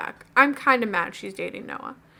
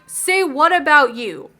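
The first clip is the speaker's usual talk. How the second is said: louder, slowed-down "say what?"; indignant retort; 4 semitones higher, 7 dB louder, slower than usual. Retold or imitated. Girl retorting to Noah's accusation, loud slow indignant retort. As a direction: throw it back at him, offended. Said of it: "And I'm like".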